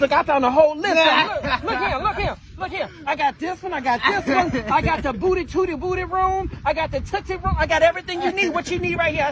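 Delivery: put-on a kid proudly showing off his finds, giggling; imitating excited son. Son excitedly showing strip club list.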